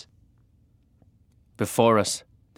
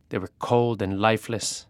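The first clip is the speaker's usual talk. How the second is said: plain reading voice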